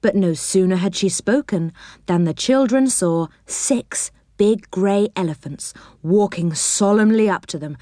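ordinary delivery, nothing marked

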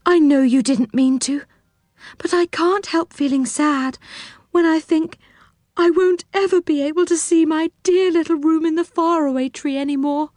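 high, mildly tearful voice; acted sadness, pitched high and plaintive; sobbing character. A narrator's reading. Silky grieving her little room in the tree, high and tearful. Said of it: "sobbed Silky".

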